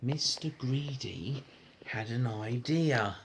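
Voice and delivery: plain reading voice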